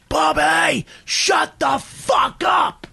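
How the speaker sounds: high, drawn-out mock scream; furious scream. Patrice screams at Bobby in the cab, furious retold shout. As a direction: scream each word out slowly, fed up. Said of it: "never seen him scream at anybody like that".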